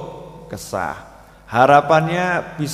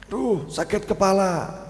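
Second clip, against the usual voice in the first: mild complaining wife; 4 semitones higher, 4 dB quieter, slower than usual. Retold or imitated. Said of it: "istrinya teriak".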